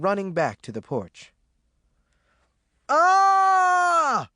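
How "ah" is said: loud, high, sustained wail; continued wailing. A narrator's reading. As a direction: wail out a long "ah". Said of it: "Ruby continued".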